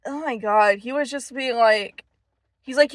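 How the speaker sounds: normal talking voice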